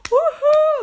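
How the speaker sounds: high, loud cheering whoop; loud whoop of applause from the pool crowd. Retold or imitated. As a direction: cheer the dancers on loudly. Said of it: "vi bara".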